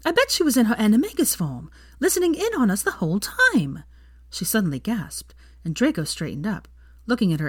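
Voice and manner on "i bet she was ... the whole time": higher, indignant realisation; a hint of Hermione catching on; lightly voiced young woman. Hermione works out the spying to Draco, lightly indignant.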